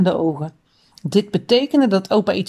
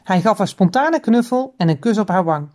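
usual speaking voice

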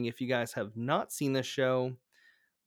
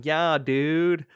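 put-on laid-back surfer dude accent; a louder, higher California surfer drawl; 4 semitones higher, 8 dB louder than usual. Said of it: "I'm just hearing the surfer".

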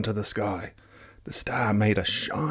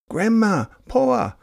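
high, louder call across the beach; 6 dB louder, slower than usual. A narrator's reading. Girl calling her grandparents across the beach, high and loud. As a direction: call out to them from afar. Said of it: "Te Rere Hua called".

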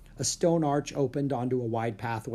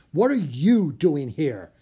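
louder, slow, annoyed demand; scowling demand; 3 semitones higher, 7 dB louder, slower than usual. Acted. Leonardo whirling on the intruding children, annoyed. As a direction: glare and demand it. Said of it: "He was scowling".

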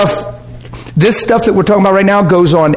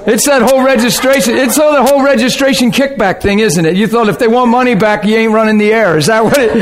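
normal talking voice

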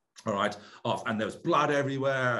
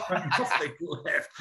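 ordinary delivery

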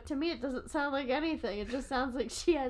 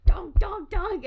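loud, higher "donk" thuds; heavy footsteps; 7 semitones higher, 8 dB louder, slower than usual. Retold or imitated. Mom stomping toward the room, heavy footsteps. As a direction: thump out each donk. Imitates mom's approaching footsteps.

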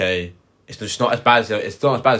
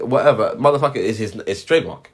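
ordinary talk, nothing marked

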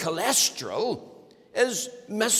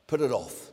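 low, flat command, PUT hit; low firm retort; 8 semitones lower than usual. Acted. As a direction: cut the excuse short. Retort after wife's rant.

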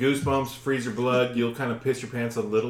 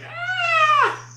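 very high, wailing mimicked scream; imitated scream; 4 dB louder than usual. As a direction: mimic a shrieking cry in the night. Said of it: "And it was like".

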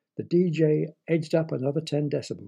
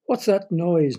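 loud, higher-pitched moan; raised, put-upon groaning question; 4 semitones higher, 6 dB louder, slower than usual. A narrator's reading. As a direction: moan it in sleepless annoyance. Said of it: "moaned Gemma".

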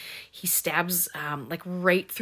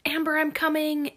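much higher, mock-frantic call; imitated urgent call; 10 semitones higher than usual. Girl rushing to save her friend, high mock urgency.

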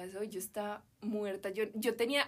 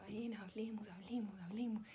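hushed pleading whisper, much softer; imitated whisper; 11 dB quieter than usual. Boyfriend urging her to talk in front of friends, whispered. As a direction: breathe the words like begging in secret.

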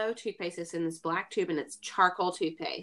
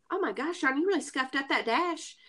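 Southern imitation; 6 semitones higher than usual. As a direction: lightly mimic a Southern friend. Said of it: "he said it real Southern".

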